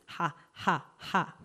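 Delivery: mocking laugh. The adults mocking the kids.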